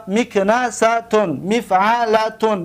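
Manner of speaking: مکنستون and مفعلتون said slowly, syllable by syllable; teacher sounding out the pattern; careful slow recital of مکنستون and مفعلتون; slow model pronunciation. Shown as a demonstration. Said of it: "ببینید".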